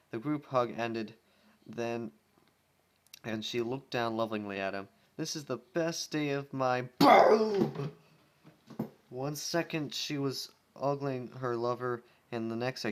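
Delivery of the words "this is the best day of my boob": loud, slow, overjoyed gush; gushing joy. Acted. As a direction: swoon over your love out loud. Rainbow Dash gushing.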